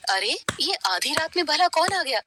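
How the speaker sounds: plain reading voice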